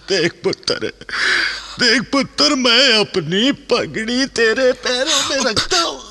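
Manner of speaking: high, pleading cry of a begging father; desperate pleading. Acted. Father begging his stubborn son, high desperate plea. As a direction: beg him, voice climbing high.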